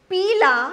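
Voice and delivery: पीला with a long, stretched ee; long vowel. Shown as a demonstration. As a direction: draw out the first vowel of पीला. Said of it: "उस सुर को लंबा खींचेंगे".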